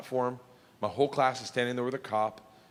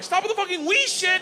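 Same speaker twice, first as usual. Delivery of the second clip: higher and louder, exasperated; panicked yell; 5 dB louder than usual. Retold or imitated. The narrator yelling at Igor to stop, panicked and loud. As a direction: cut him off in a frantic shout.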